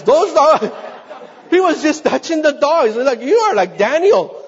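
high, loud, incredulous retelling; amazed exclamation. Retold or imitated. Preacher telling the congregation about the fearless door knocker, loudly amazed. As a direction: exclaim it in amused disbelief, pitched right up.

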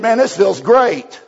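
a convert crying happy tears, slow and loud; tearful joy. Retold or imitated. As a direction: let the joy swell through the words. And tears are rolling out.

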